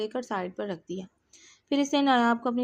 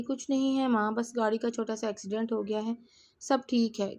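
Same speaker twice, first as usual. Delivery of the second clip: ordinary delivery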